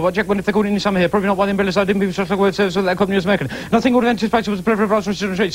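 very fast talking. Shown as a demonstration. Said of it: "go ahead".

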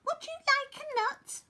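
very high, tiny squirrel voice; squeaking squirrel. A narrator's reading. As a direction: squeak the offer like a little squirrel. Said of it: "squeaked a squirrel".

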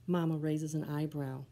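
usual speaking voice

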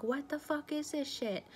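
high, indignant burst of outrage at the burning; retold angry outburst. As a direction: relive the shock and anger of being tricked. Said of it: "and I got mad".

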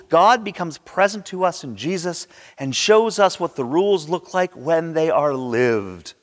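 usual speaking voice

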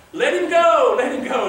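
high, loud cry easing on the repeat; crowd calling out. Retold or imitated. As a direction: call it out like a voice in the crowd. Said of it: "And they said".

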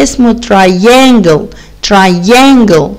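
"triangle" slow and clear; repeat word. Shown as a demonstration. Teacher repeating the word for children. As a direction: say "triangle" slowly. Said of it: "اسمه إيه".

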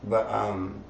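ordinary delivery

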